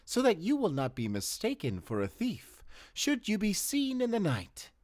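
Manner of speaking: high, distinct character voice for the warning; voiced character. A character warns Casper to show his face, in a high put-on voice. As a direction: voice the advisor high and apart from the narrator.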